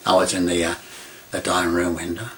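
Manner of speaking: normal talking voice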